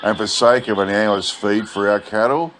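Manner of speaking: ordinary delivery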